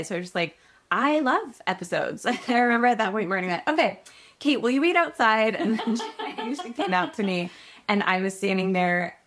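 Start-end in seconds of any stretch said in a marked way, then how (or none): 0.9-2.3 higher, drawn-out fake enthusiasm; awkward eager blurt. Actress blanks before producers, awkwardly over-eager. Nervous blurted answer in meeting. 3.7-5.5 high, louder mock-polite cut-in; abrupt dismissal. Casting director sends floundering actress out, hastily polite.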